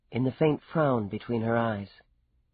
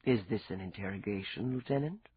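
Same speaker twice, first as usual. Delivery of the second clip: soft, low, quietly amused; understated amusement, spoken low and soft; smile in the voice; 4 semitones lower, 7 dB quieter than usual. A narrator's reading. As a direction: tease gently, lifting at the end. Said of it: "the smile in his voice".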